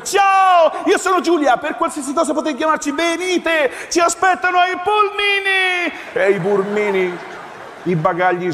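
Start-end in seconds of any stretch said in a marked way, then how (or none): 0.0-5.9 gushing welcome. Acted. Resort rep greeting the tired newlyweds at the airport, shrill with forced joy.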